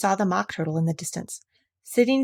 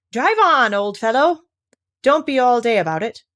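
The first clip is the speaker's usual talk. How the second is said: loud, higher, hurry-up bark; impatient urging; 5 semitones higher, 6 dB louder than usual. Acted. Gryphon hurrying the Mock Turtle, loud and impatient. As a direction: shove him along impatiently.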